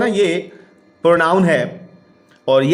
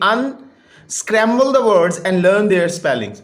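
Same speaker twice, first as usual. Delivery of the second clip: slow reading aloud; much slower than usual. Shown as a demonstration. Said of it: "teacher reads task aloud".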